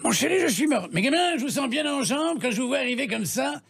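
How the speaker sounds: high put-on character voices, switching; morphing voice. Shown as a demonstration. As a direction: jump from voice to voice, high. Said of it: "Vous avez un exemple sous la main". (voix qui changent, aiguës)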